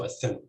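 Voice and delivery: a THIN, second syllable stressed. Shown as a demonstration. Lecturer giving another example, a thin stressed on THIN. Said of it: "with stress on the ... second syllable".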